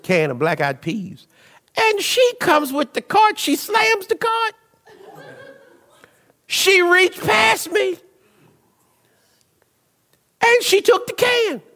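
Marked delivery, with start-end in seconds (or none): 1.8-11.6 much higher, indignant storytelling; hammed-up outrage. Pastor telling the church about a lady stealing his can, hammed-up outrage. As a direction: climb high with comic disbelief.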